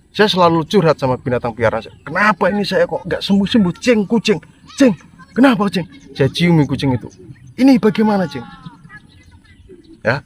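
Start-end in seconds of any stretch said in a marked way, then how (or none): 2.0-5.9 acted plaintive venting. Re-enacts venting to his pet cat. 7.6-8.4 acted plaintive venting. Re-enacts asking his cat for answers.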